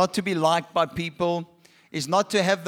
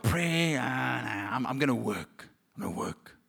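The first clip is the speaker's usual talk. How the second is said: sinking into a low, soft grumble; dismissive; 9 semitones lower, 7 dB quieter than usual. Acted. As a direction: wave it away, dropping lower and softer. Voicing someone dismissing prayer.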